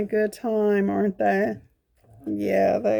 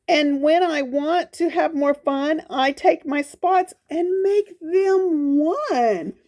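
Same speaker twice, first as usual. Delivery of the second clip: sing-song storybook read of the leopard's boast; reading to children; 6 semitones higher than usual. Acted. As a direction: read it like a proud little rhyme to kids. Reads the leopard's rhyme.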